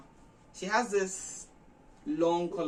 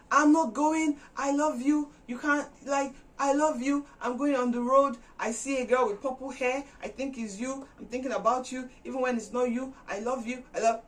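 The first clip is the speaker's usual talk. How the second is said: stubborn; 8 semitones higher, 4 dB louder than usual. Retold or imitated. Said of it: "Darian became stubborn".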